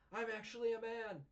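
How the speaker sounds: high, bright squeaky claim of being a man; mock high voice. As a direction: pitch up into a playful fake reveal. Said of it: "Nami's like".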